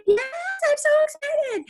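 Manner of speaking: very high, drawn-out gleeful squeal; excited. Shown as a demonstration. Trainer demonstrates tip two to her team, squealing with excitement. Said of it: "Number two is excited".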